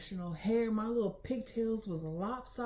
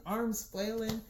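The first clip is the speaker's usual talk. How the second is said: usual speaking voice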